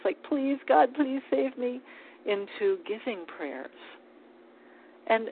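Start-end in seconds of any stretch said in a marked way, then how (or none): 0.2-1.8 high, mildly acted begging prayer; pleading. Speaker giving an example of prayers to turn around, pleading. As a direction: plead for rescue in a lifted voice. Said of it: "pleading prayers".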